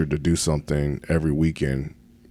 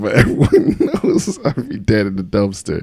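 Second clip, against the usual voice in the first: laughing while admitting it; 5 semitones higher, 5 dB louder than usual. Real, not acted. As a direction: chuckle through the line. Laughs at wife's joke.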